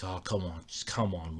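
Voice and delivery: soft, low plea under the breath; quiet pleading. Retold or imitated. Driver quietly begging his stalled car to start. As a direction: coax the dead car quietly.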